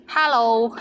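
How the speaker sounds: hello in a Cantonese accent; Cantonese-accented hello, held longer. Shown as a demonstration. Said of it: "or with the Cantonese accent".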